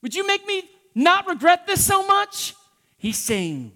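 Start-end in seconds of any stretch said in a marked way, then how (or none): 0.0-2.5 high, loud whiny plea; self-pitying plea. Retold or imitated. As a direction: ask to feel better, pitying yourself. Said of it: "he's not just saying".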